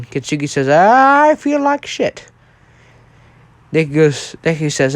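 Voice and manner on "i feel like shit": long, high, drawn-out groan of words; groaning injured brother. Acted. Injured brother answers how he feels, groaning. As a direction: drag it out slowly, high-pitched.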